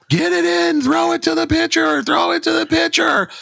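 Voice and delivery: very high-pitched mock holler, held on one note; imitated sideline screaming. Imitating rec ball parents hollering all summer, mock screaming. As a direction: holler it like frantic parents from the bleachers. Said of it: "Please stop screaming for everyone to throw".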